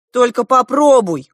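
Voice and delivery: громкий, высокий выкрик с угрозой; крикни с вызовом, выше обычного. A narrator's reading. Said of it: "крикнула я". (громкий угрожающий крик)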